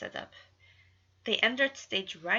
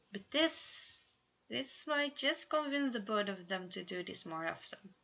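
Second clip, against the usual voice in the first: usual speaking voice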